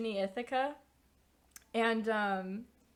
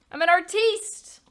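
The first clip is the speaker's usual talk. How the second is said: much higher, louder and slower, grandly affected; mock pompous; 9 semitones higher, 12 dB louder than usual. Streamer mocking her own NFT ambitions, hammed-up grand proclamation. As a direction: declare it like a self-important artiste.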